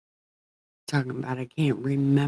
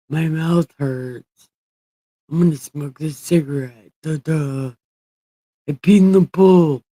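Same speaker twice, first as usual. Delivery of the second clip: mock whiny voice; 3 semitones higher, 6 dB louder than usual. Mocking his own complaint.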